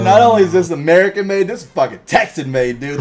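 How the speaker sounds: put-on loud, stoked good-old-boy voice; a touch of hammed-up Texan bro; hyped Texan dude. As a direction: brag it like a proud Texan. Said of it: "they're like".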